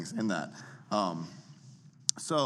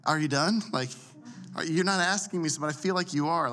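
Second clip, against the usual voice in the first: high, louder, baffled questioning; puzzled listener; 8 semitones higher, 5 dB louder than usual. Acted. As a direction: act out a listener thrown by uptalk. Acting a confused listener of uptalk.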